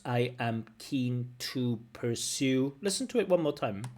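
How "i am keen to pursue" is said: slow, evenly spaced robotic delivery; mechanical. Shown as a demonstration. Teacher mimicking the candidate's answer, mechanical and slow. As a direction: plod word by word. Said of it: "Notice how mechanical this answer sounds".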